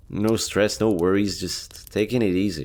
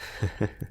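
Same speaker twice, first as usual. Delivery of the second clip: short breathy laugh; 3 semitones higher, 7 dB quieter than usual. Acted. Joke about being stabbed.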